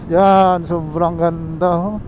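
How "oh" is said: ordinary delivery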